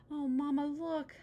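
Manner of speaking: put-on high, eager child's cry; excited child. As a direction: pipe it up like a thrilled cub. Sister excited about TV ad.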